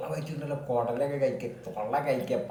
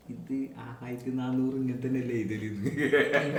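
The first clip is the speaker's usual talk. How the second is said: quoting mother, laughing; 3 semitones lower, 9 dB quieter than usual. Retold or imitated. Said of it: "എന്നോട് ചോദിക്കുക".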